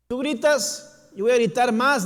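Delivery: high, loud, drawn-out shout over the crowd; defiant shout. Acted. Blind beggar shouting down those who silence him, defiant shout. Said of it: "dijo Bartimeo".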